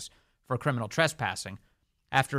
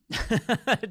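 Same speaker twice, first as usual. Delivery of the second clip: real laugh; 10 semitones higher than usual. Real, not acted. Host laughs at clip.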